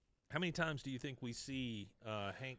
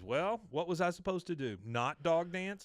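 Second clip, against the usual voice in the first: put-on higher-pitched retort as a kid; retold defiance; 7 semitones higher than usual. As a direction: imitate a son talking back. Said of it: "Bobby is really defiant right here".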